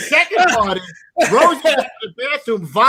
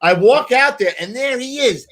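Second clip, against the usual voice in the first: ordinary talk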